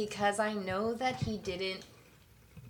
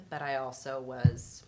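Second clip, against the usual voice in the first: normal talking voice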